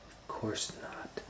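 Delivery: slow hushed whisper; whispered reply. A narrator's reading. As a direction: brave boy whispering in the dark. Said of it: "said Hansel".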